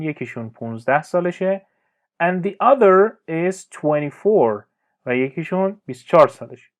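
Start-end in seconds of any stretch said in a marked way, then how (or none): 2.2-4.6 slow model reading. Shown as a demonstration. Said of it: "teacher models English sentence".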